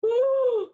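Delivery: sharp loud high gasp. Acted. Reader gasps at the dragons crunching. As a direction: gasp big in alarm.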